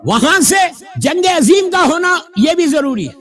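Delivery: high, forceful sermon delivery; preaching. Acted. Preaches at raised pitch. (پرجوش خطیبانہ انداز)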